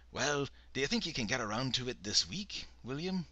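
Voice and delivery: put-on losing patience in a Scottish accent; exasperated Scottish brogue. A narrator's reading. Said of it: "He sounded exasperated".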